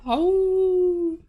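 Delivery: high yipping howl; howling like a wolf pup. Pretend. Howl again.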